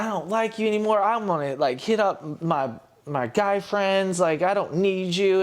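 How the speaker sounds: high-pitched retold tirade; half-voiced angry girlfriend quote; imitated screaming. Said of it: "she's screaming. She's like".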